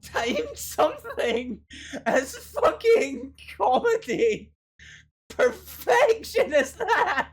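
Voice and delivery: high, loud words through helpless laughter; laughing hard. Real, not acted. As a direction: crack up so hard you can barely speak. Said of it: "my sides hurt".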